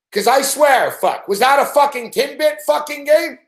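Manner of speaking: high-pitched, incredulous swearing rant; real outraged tirade; exasperated rant. Real, not acted. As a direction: rail at the team and coach, voice pushed high. Exasperated rant at losing team.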